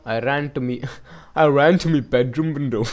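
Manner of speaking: put-on a half-hearted Scottish accent, laughing through it; a lightly done Scottish accent for the old neighbour. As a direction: try a rough Scottish brogue and crack up. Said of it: "Is he Scottish?".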